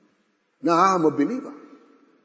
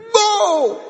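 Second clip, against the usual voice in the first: jeering shout; 4 dB louder than usual. Acted. Mockers' jeer again.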